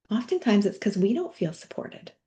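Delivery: usual speaking voice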